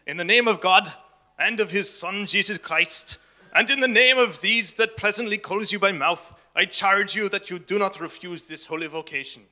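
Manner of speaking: put-on loud, preachy Scottish accent; Scottish preacher charging Knox from the pulpit, in a Scottish accent. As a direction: boom it out like a Scottish preacher in full Scottish brogue. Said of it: "Is that any good? Any Scottish people in the room?".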